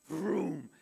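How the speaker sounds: drawn-out engine whoosh; spoken "vroom" sound effect; imitated truck vroom. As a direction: mimic a truck rushing past. Imitates a passing transport truck.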